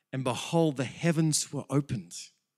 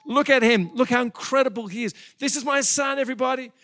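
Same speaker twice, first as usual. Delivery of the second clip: high, loud, showing off his son; a father shouting his pride in his son; proud boasting; 10 semitones higher, 6 dB louder than usual. Retold or imitated. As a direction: brag to everybody about your boy. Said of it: "It's like all heaven's going".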